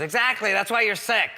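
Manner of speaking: high, loud gotcha; triumphant quack. Retold or imitated. Rob scolding sick Adam, mock-triumphant shout. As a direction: pounce on it emphatically. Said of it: "He goes".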